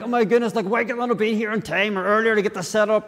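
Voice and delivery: high-pitched inner complaint; mock frustration. Preacher voicing thoughts about being late for setup, frustrated. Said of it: "and all frustrated".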